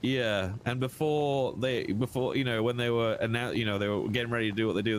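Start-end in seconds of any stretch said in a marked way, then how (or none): none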